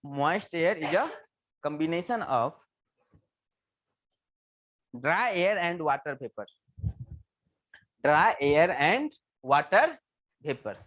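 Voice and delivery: very slow, spaced out for note-taking; slow dictating teacher; slow dictation. Shown as a demonstration. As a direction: spell it out phrase by phrase. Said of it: "एक चीज लिखो तो".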